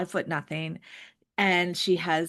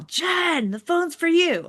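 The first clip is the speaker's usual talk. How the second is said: much higher, louder call down a corridor; retold yell, pitched high and loud; yelling down the hall; 11 semitones higher, 4 dB louder than usual. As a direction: holler the name to someone far off. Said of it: "someone's yelling down the hall".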